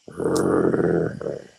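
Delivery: low rumbling growl from the throat; imitated growl. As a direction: growl it low like an animal in the woods. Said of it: "You hear something go".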